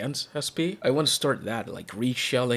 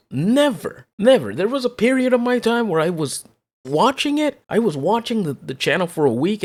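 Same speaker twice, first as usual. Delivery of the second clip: louder and louder, fired up; escalating comedy rant; 3 semitones higher, 3 dB louder than usual. Real, not acted. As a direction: build the rant to a loud peak. Rant escalates against Linus.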